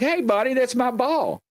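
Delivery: put-on high, timid boy's voice; nervous kid asking. Boy asking the gnome for his ball back, high and timid. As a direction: politely ask the creature for your ball.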